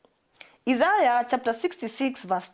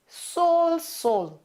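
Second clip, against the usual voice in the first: calling Saul; 6 semitones higher than usual. Acted. Voice of Jesus calls Saul.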